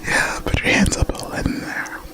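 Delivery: breathy close whisper; ASMR whisper. Acted. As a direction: whisper right into the mic. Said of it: "in A S M R voices".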